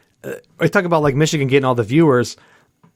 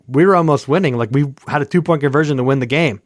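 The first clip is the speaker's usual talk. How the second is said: usual speaking voice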